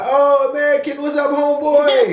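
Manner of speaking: high-pitched, hammed-up buddy greeting; mock friendly soldier. Soldier on hearing his American accent, mock chummy. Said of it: "he was like".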